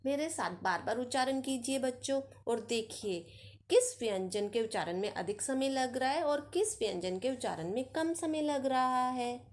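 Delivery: normal talking voice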